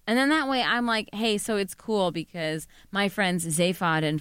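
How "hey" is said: ordinary talk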